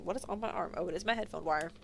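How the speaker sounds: ordinary delivery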